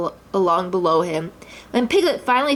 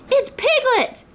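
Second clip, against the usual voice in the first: much higher startled exclamation; surprised; slower than usual. A narrator's reading. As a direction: Kanga realises it's Piglet. Kanga recognises Piglet.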